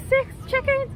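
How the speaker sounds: high, squeaky disbelief; shocked squeal. Retold or imitated. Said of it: "I was like".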